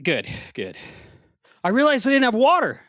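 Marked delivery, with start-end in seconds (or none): 0.0-0.8 quiet, winded reply; catching breath between words. Real, not acted. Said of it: "you're a little out of breath".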